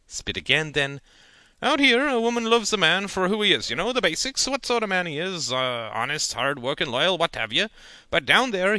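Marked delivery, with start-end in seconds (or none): 1.6-8.8 higher, louder folksy uncle; lecturing uncle. A narrator's reading. Uncle warns nephew about Big City Ladies, folksy voice. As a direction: talk down to the boy kindly.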